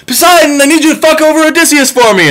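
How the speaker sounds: high shrill-toned petulant demands; whiny sun god. Retold or imitated. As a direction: complain like a spoiled god missing his cows. Sun asks Poseidon for revenge.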